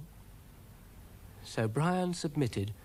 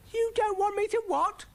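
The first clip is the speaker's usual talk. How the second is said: very high, loud panicked squeak; alarmed little snail, squealing; panicking; 5 dB louder than usual. A narrator's reading. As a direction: shoot the voice right up in panic.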